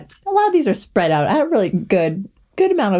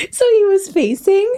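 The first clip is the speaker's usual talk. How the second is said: laughing; 11 semitones higher, slower than usual. Real, not acted. Laughing through the story.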